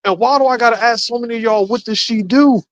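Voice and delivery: high, incredulous what-the-hell question; baffled asking. Retold or imitated. Asking his coworkers, baffled, high-pitched and disbelieving. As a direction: raise it up in disbelief, rushing the middle. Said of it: "I'm sitting here like".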